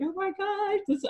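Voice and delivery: high-pitched retold "overcome" exclamation; imitating emotional outburst. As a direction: play grandma getting all emotional, lightly. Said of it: "she was emotional".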